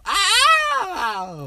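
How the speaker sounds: very high, loud drawn-out ow; hammed-up wail of pain; exaggerated mock yelp. Mocking the co-host's reaction to a slap, exaggerated yelp. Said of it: "That was me exaggerating".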